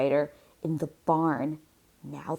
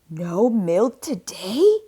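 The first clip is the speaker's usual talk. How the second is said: loud, higher shocked cry of the farmer; 5 semitones higher, 8 dB louder than usual. A narrator's reading. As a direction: farmer cries out in loud disbelief. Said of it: "cried Farmer Brown".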